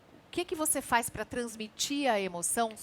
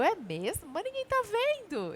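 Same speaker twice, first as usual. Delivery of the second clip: put-on doubtful young self; 10 semitones higher, slower than usual.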